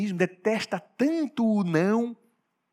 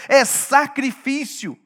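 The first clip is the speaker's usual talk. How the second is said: ordinary talk, nothing marked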